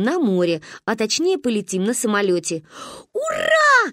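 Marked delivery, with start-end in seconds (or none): 3.1-3.9 высокий громкий крик; орать от радости; заорали. Acted. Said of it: "заорали мы". (громкий восторженный вопль)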